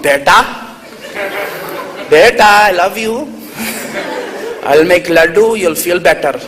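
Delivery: put-on doting Desi mother with an Indian accent; Indian mom. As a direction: comfort your child in an Indian mother's accent. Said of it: "The Indian mom".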